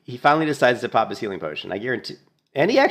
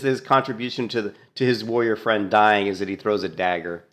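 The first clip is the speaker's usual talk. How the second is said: ordinary delivery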